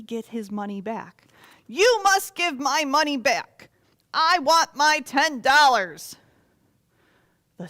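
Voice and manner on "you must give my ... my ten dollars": loud, higher demand, slowing on the last words; greedy lender insisting on ten dollars, loud; demanding debtor. Acted. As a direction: bark the demand at the servant. Debtor demands his money.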